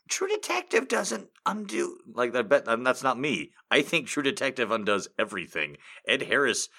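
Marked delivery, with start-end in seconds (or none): 0.1-2.0 high, incredulous mock voice; mock protest. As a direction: act out the outraged fans, pitched high. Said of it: "We're like".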